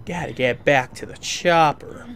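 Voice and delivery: slow, drawn-out mock movie-hero line; mimicking game character.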